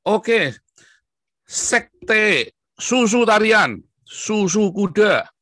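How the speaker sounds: high, loud, slow mockery; mocking announcement. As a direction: sneer it out like a showman.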